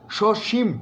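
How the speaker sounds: সসীম with both স said as শ, shoshim; pronunciation demo. Shown as a demonstration. Teacher showing সসীম with two sh sounds. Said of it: "দুটোই তালব্য স".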